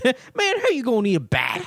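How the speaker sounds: high, hammed-up comic outrage; mock stand-up comedian. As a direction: imitate a comedian in pretend disbelief.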